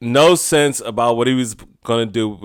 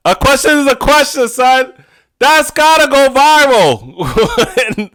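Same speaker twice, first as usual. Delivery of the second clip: much higher and louder, dragged out; exasperated outburst; 11 semitones higher, 5 dB louder, slower than usual. Real, not acted. Mocking the fake question line, a loud high rant. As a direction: lecture him in disbelief.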